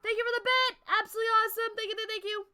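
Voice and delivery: high, loud gushing thank-yous; excited thanks. Real, not acted. Streamer thanking a chatter for bits, high and loud. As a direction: gush over the gift.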